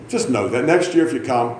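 ordinary talk, nothing marked

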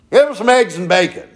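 loud, high, rude food order; demanding holler. Acted. Drunk husband ordering breakfast from his wife, hollering rudely. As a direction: shout the order at her like a boorish husband.

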